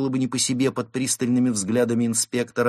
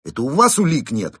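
loud, raised comeback; parrying retort; 7 semitones higher, 8 dB louder than usual. A narrator's reading. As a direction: throw the accusation straight back. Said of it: "парировал Константин". (громкий ответный выпад)

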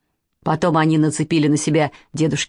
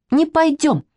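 put-on higher-pitched childish refusal, in a huff; sulky girl; 8 semitones higher than usual. A narrator's reading. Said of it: "надулась Катя". (обиженно, высоким детским голосом)

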